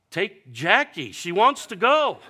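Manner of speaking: high, loud, slow bargaining; grieving son bargaining with God, high and loud; mock plea to God. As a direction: shout a cheeky trade up to heaven. Pleading with God.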